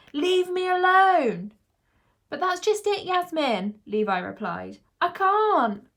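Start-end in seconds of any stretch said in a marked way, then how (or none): 0.1-1.5 high, loud, drawn-out demand; angry outburst. A narrator's reading. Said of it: "wrote in angry letters". 2.3-3.8 high-voiced llama protesting; pleading llama. A narrator's reading. Levi pleads with Yasmin. 5.0-5.9 high, loud protest; exasperated llama. A narrator's reading. Levi refuses, exasperated.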